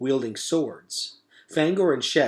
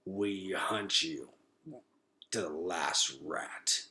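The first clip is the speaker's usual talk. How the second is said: soft, very slow dying rat voice; last threat with failing breath; gurgling; 5 semitones lower, 10 dB quieter, much slower than usual. Acted. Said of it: "he gurgled".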